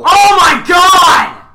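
very loud, high, stretched-out shout; mock-shocked scream. Answering a co-host's outburst, shocked scream. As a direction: wail it at full volume.

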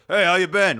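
loud, put-on voice of a guy proving himself; Brian overcompensating. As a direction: overdo a hearty greeting, loudly. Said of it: "his voice got up".

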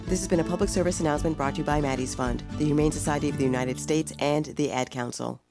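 rushed legal tag; fast disclaimer read. Acted. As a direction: rattle off the credits quickly.

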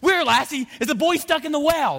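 very high, loud guessing voice; mock Lassie charades. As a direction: guess eagerly like talking to Lassie. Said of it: "He's going".

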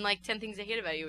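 normal talking voice

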